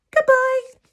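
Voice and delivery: high, loud call across the water; boy calling farewell to the penguin from the boat; calling goodbye. Acted.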